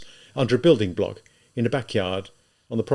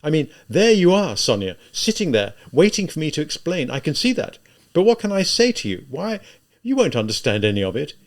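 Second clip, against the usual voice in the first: high, loud, exasperated pleading; anguished, insistent questioning; agitated outburst; 8 semitones higher, 6 dB louder than usual. A narrator's reading. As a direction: pour it out in torment, pitched up and forceful. Tormented outburst at weeping Sonia.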